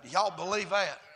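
loud, driving preacher's cadence; full-force preaching. Real, not acted. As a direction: preach it in punched, shouted phrases.

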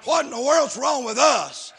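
loud, emphatic pulpit delivery; forceful Southern-revival sermon cadence; fervent preaching. Real, not acted. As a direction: preach it hard, stretching the key words.

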